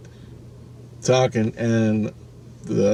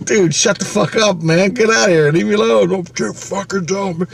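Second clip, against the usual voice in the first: telling demon off; 9 semitones higher than usual. Retold or imitated. Him telling the demon to leave, exasperated raised voice. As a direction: snap back at the spirit, fed up and raised.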